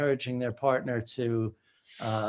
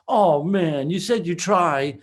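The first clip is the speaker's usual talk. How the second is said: raised, acted whine of disappointment; complaining; 4 semitones higher, 5 dB louder than usual. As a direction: act out a let-down partner pushing back. Acting out pushy partner's complaint.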